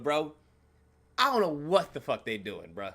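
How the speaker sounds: ordinary talk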